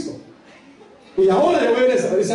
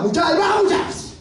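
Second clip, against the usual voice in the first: calling out; 9 semitones higher than usual. Acted. Executioners calling before burning martyrs.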